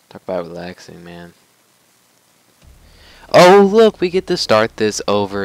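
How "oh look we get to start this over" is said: loud, high mock cheer; sarcastic outburst. Real, not acted. Player forced to restart the fight, loud sarcastic mock cheer. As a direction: fake enthusiasm, loud.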